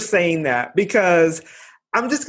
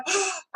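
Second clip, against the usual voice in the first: high, quick mock gasp; delighted gasp; 12 semitones higher, 8 dB quieter than usual. Listener seeing a speaker fix a mistake, acted high gasp. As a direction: gasp as if suddenly realizing you can.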